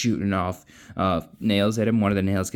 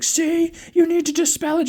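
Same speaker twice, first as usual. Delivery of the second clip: higher, urgent telling-off; urgent instruction; 4 dB louder than usual. Retold or imitated. Kel urging Lila while the magic chokes her, higher urgent. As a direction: press her to act now.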